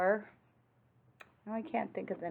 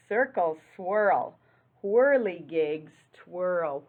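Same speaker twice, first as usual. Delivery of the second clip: lively read-aloud rhyme; 6 dB louder, slower than usual. Acted. Reading rhyming picture book aloud.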